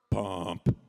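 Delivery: low, very loud "Pump"; deadpan blurt. Shown as a demonstration. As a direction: blurt "Pump" deep and forceful. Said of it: "look straight into her eyes and go".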